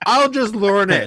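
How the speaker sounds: exasperated outburst. Retold or imitated. Quoting impatient friend grabbing rulebook.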